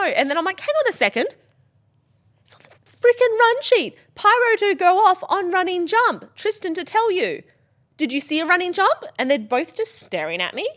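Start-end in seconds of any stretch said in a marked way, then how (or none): 0.6-9.0 high, loud furious scolding; angry rant. Retold or imitated. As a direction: tear into them.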